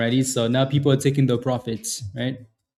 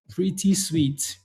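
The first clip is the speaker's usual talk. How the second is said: savoring slowly; 3 semitones higher, 4 dB quieter, much slower than usual. Shown as a demonstration. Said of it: "Pretty sweet, huh?".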